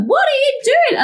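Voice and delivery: high panicked cry; inner scream. Retold or imitated. Retelling screaming at herself, high and frantic. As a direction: plead with yourself to stop. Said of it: "I was screaming at myself".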